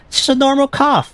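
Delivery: high mock-innocent sarcasm; sarcastic. Real, not acted. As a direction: pretend it's nothing, very high. Sarcastic aside on foreshadowing.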